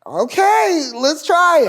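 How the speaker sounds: loud, high, exaggerated agreement; mock giving in. Comedian gives in to the writer, imitated high and loud. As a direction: imitate her caving, voice way up and loud.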